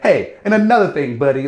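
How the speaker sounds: put-on high, loud old-movie tough guy; mock tough guy. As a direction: ham up a clumsy threat.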